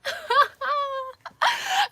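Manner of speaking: long, high helpless laughter; loud, drawn-out giggling fit; real laugh. Real, not acted. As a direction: laugh hard and high at yourself. Amused by her own mole mix-up.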